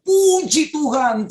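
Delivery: high, drawn-out exclamation of praise; retold cry of praise. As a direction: re-enact crying out in thanks, pitched high and stretched. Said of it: "saya langsung teriak dari kamar".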